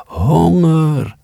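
long high howl of hunger; howling dog. A narrator's reading. Starving dog howling into the night, long high wail. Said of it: "Hij huilde met lange uithalen".

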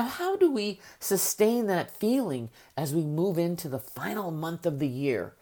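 ordinary talk

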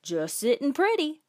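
high singsong parrot phrase; trained talking bird. Acted. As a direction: chirp it upward like a pet bird. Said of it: "birds that he'd trained to say clever things".

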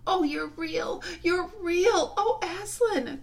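put-on high, loud girlish rapture; soaring, high-pitched delight; overjoyed cry. A narrator's reading. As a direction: cry out with joy and relief. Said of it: "cried Lucy".

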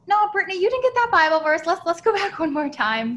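loud, high self-scolding to go back; scolding herself. Retold or imitated. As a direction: snap at yourself to stop and look again. Scolding herself to reread verse.

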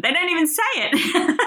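laughing high through the words. Real, not acted. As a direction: giggle it out, delighted. Delighted by the story.

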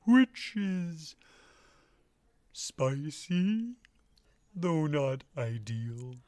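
slow halting comic drawl; Emo Phillips voice. Acted. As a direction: draw words out with odd pauses. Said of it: "I'm doing Emo Phillips".

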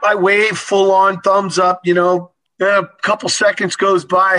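normal talking voice

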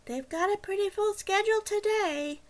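a hint of a dispatcher who cannot be bothered. A narrator's reading. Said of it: "She sounded even more bored".

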